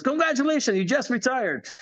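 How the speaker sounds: mock upline. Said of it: "He says".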